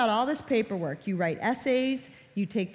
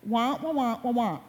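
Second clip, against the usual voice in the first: muffled trombone wah-wah of an adult nobody understands; Charlie Brown's teacher; 2 semitones higher than usual. Retold or imitated. Mom imitating Charlie Brown's teacher droning to kids. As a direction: mimic the meaningless drone of the cartoon teacher. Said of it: "it's like Charlie Brown's teacher".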